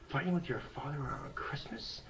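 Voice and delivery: hushed mock mom scolding; whispered scolding. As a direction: hiss it like a mom keeping it down. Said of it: "My mom's like".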